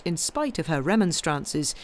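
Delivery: plain reading voice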